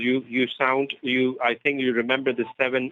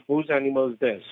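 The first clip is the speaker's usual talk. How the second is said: ordinary talk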